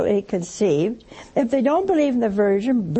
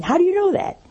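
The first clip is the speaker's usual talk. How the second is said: higher-pitched mock challenge; argumentative uncle; 7 semitones higher, 3 dB louder, faster than usual. Uncle challenges niece's weather remark, lightly argumentative. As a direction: play a contrary old uncle picking a fight. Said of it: "someone who's argumentative".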